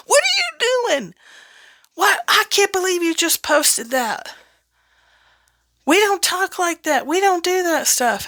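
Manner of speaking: shocked parent. Retold or imitated. Parents scolding over posts, high-pitched and appalled. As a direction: squeak out parental disbelief. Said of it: "it might be your mom and dad".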